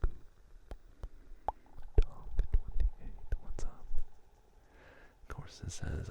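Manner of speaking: hushed, unhurried ASMR whisper. Real, not acted. Host greeting stream viewers in an ASMR whisper. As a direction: whisper close to the listener, lingering on words.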